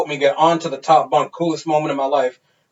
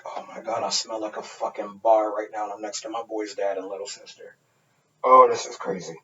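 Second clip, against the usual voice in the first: panicked whisper; 6 semitones lower, 9 dB quieter than usual. Retold or imitated. Embarrassed next to friend's dad.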